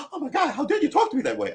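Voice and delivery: high, fast, mock-indignant woman; offended woman. Woman outraged at how he spoke, high and fast. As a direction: play up her shock at the insult.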